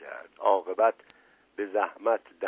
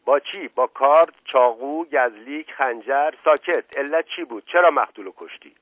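high, loud rapid-fire questioning; barking interrogator; 4 semitones higher, 8 dB louder than usual. A narrator's reading. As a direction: fire questions like a police inspector. Inspector fires questions at suspect. (بازجویی تند و بلند)

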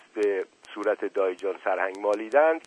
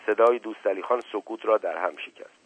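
ordinary delivery, nothing marked

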